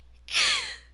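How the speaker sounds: loud, squealing burst of laughter; high-pitched laugh. Real, not acted. Cracking up at her own joke.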